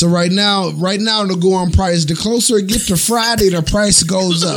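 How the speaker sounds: hyped-up sales pitch voice; infomercial. Pretend. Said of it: "Yo, I'm doing the infomercial".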